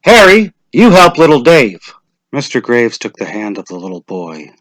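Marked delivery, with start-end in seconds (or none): 0.0-1.8 official voice. Acted. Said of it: "Mister Summers said".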